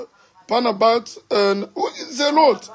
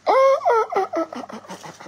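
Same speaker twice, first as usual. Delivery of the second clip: high delighted squeal trailing off; a sudden high ah-ah-ah of delight that fades to nothing; excited squeal. Real, not acted. Excited over the finished dish.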